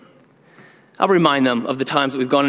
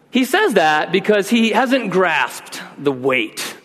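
normal talking voice

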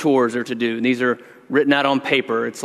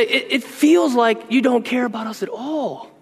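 higher, rising exasperation; worked-up dad; 9 semitones higher than usual. Retold or imitated. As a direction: pour out a father's hurt frustration, much higher. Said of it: "I'm all worked up by this point".

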